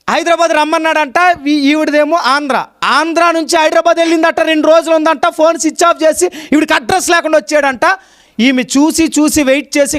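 high-pitched, insistent retelling from the pulpit. Real, not acted. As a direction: pitch the story up to the congregation.

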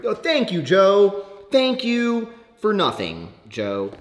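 high, drawn-out bitter gratitude; sarcastic thanks. Real, not acted. Blaming the friend for the frother, sarcastic. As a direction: thank him with heavy sarcasm.

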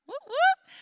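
high, loud double whoop; celebratory whoop. Real, not acted. Cheering that wisdom is a woman.

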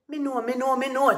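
high, very loud repetition; impatient shout. A narrator's reading. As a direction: shout it over him in exasperation. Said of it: "Tiger shouted".

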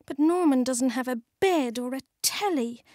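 ordinary delivery

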